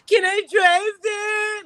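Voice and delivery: very high, loud, stretched-out plea; drunken wailing. Retold or imitated. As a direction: whine the question out like a tipsy kid. Retells her drunk, loud plea.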